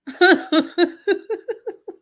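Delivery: loud, long laugh; laughing hard at the man's story; big burst of laughter; mocking laugh. Real, not acted.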